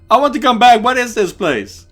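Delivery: high, loud, urgent plea; frantic demand. Acted. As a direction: cry out to be taken back. Demands to return.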